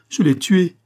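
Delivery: high, loud announcement. A narrator's reading. Monique realises aloud she killed madame, said out loud. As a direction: state it aloud with lifted voice. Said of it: "et dit tout haut".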